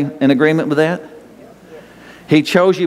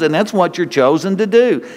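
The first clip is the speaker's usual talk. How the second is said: ordinary talk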